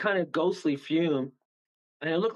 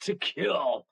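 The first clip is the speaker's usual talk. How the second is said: mock demon voice, drawn out; lightly voiced demonic threat; huge evil voice. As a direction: put on a huge voice for an evil entity. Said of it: "this huge voice".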